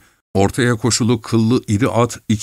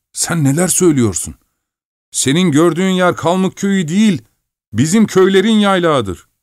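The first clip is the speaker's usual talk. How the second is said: higher, fussy miller's voice; grumbling miller; 9 semitones higher than usual. Acted. Miller scolding the driver about the lost way, grumbling. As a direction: nag him about the lost road.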